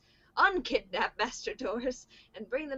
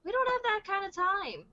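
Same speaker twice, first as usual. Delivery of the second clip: urgent; 9 semitones higher, 6 dB louder than usual. Acted. Character line in movie recap.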